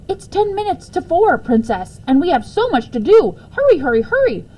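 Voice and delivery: mock urgent hurry. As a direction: hurry the princess along in a game. Urgent pretend game.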